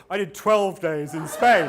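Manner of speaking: loud, high laughing brag; mock boastful bloke; one-upping bloke. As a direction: top them all, laughing. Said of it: "he goes".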